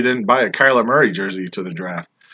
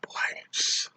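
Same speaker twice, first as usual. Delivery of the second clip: dramatic whisper; 14 dB quieter than usual. Pretend. Dramatic reveal of bottom tier.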